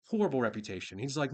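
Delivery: ordinary delivery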